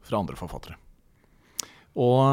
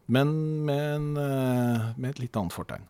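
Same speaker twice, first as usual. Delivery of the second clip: ordinary talk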